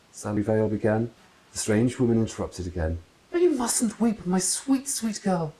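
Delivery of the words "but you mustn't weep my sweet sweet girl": hammed-up sympathy; cooing aunt. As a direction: gush tenderness over her.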